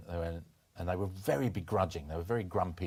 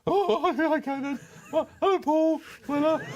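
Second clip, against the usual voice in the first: much higher and louder, giggly and flustered; starstruck younger self; 6 dB louder than usual. Retold or imitated. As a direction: play your dazzled younger self taking a famous call. Retold starstruck reply to Weller.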